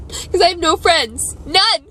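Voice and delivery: crying. Real, not acted. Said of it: "and I'm still crying".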